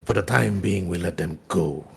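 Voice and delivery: low, soft villain voice; hushed, low command; restrained Sith lord. Acted. Scourge answers Haunt in character.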